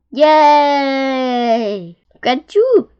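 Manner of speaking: high, loud cheer; jubilant shout. A narrator's reading. Wise old beetle to successful young beetle, jubilant shout. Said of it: "shouted the wise old click beetle".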